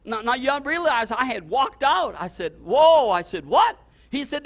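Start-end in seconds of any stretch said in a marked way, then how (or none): 2.6-3.1 loud high startled whoa. Retold or imitated. Pastor startled by the caller's news, loud whoa. 3.4-3.8 sharp high loud what; shocked what. Retold or imitated. Pastor stunned by the caller's question, high shocked what. Startled reply on the phone.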